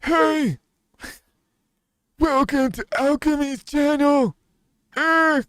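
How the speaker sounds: put-on high, loud cracking old man greeting; other old man voice. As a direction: greet your viewers like a wheezy grandpa. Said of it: "the other old man voice that I know how to do".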